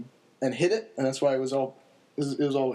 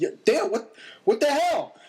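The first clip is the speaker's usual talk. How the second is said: retold shout; 12 semitones higher than usual. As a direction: call down from the porch in disbelief. Buddy calls out from porch.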